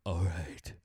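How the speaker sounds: hushed, halting surrender; converted deep voice. Acted. Co-host gives in to caller, hushed deep-voice parody. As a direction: give in low, phrase by phrase. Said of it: "about to start talking like that".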